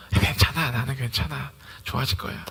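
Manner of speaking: low, soft, settled voice; calm reassurance. Acted. Believer answering a baffled friend, calmly reassuring. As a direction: quietly play the untroubled believer.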